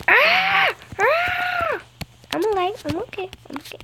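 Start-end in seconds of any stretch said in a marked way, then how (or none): 0.1-1.8 high loud screams; high panicked screams. Real, not acted. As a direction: scream as you fall. Falling in game.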